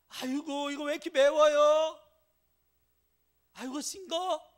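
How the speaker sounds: put-on high, loud complaining kid's voice; whiny child. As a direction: whine like a picky child about the food. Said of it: "감사해요?".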